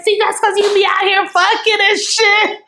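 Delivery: high, sharp accusing comeback; accusing retort. Retold or imitated. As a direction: throw the accusation back at him. She accuses him in argument.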